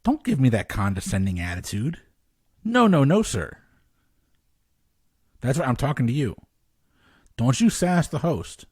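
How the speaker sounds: playfully stern, rising to a yelp; mock scolding. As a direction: scold them like a cheeky kid. Host mock-scolding a contestant.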